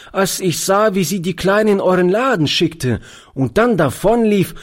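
höhere, lebhafte Jungenstimme; Gassenjunge erzählt. A narrator's reading. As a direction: als Straßenjunge munter berichten. Boy voiced in story. (Gassenjunge erzählt dem Alten lebhaft)

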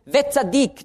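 "Wetzadik" lifted high as the key word; stressed Hebrew word. Shown as a demonstration. Preacher showing Römer's accent, high stressed word. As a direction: ring out "Wetzadik" above the rest. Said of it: "jedes Mal wird der Akzent auf ein anderes Wort gelegt".

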